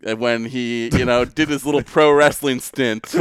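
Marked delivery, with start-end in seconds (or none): none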